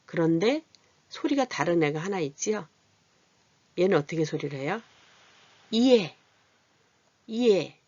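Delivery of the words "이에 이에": 이에 with a short 이 gliding into 에, higher and louder; vowel demo. Shown as a demonstration. Teacher modelling 이에 for learners, higher and louder. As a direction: glide 이에 from a quick 이 into 에. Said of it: "이렇게 발음해".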